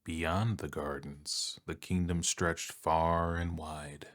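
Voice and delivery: ordinary delivery, nothing marked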